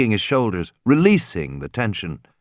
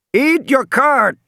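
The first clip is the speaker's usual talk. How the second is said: garbled radio voice; 14 semitones higher, 4 dB louder, slower than usual. A narrator's reading. Garbled voice over crackling radio.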